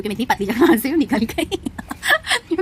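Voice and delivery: slow, giggling brag through laughter; proud laughing. Real, not acted. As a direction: laugh while showing off the squeeze. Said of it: "मैं इतरा रही हूँ".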